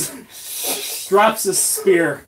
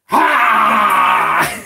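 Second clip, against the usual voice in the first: loud, higher shouted "ha"; goblin battle cry; 5 semitones higher, 6 dB louder than usual. Acted. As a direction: burst out with one big yell. Goblin turns, cries out.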